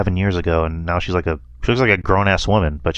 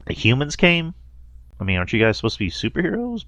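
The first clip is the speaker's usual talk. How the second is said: high, disbelieving question that speeds up; incredulous reaction; 5 semitones higher, 5 dB quieter than usual. Retold or imitated. Recalling his reaction to the story, baffled and disbelieving. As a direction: retell your own baffled reaction to a friend. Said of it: "that's another thing I'm kinda like".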